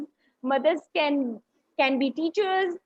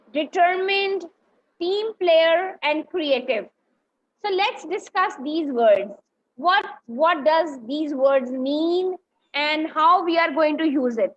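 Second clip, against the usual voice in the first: ordinary talk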